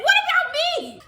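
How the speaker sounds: high, loud outraged question; indignant yell. Retold or imitated. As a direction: call her out, loud and high. Indignant at friend who ran.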